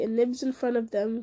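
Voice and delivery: usual speaking voice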